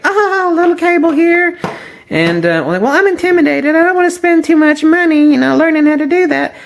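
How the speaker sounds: high, whiny mock voice; mocking timid beginner. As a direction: parody of a nervous beginner.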